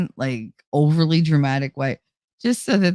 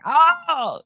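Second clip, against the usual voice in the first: high startled oh; surprised oh; 11 semitones higher than usual. Retold or imitated. Reacting to the trivia ranking, high surprised oh. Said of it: "I was like".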